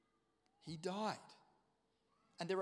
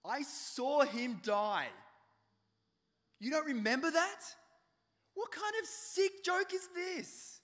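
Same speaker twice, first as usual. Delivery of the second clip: much louder, higher, slow anguished outrage; angry Thomas; 8 semitones higher, 9 dB louder, slower than usual. Acted. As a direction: shout down the cruel joke. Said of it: "Thomas says".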